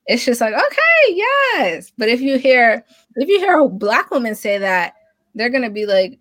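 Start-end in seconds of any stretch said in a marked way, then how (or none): 0.5-1.9 high-pitched, hammed-up hype; imitating an excited, approving fan; mock gushing approval. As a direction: cheer them on in a mock squeal.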